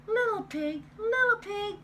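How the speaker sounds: high sing-song call at the door. Acted. Wolf calling at pig's door.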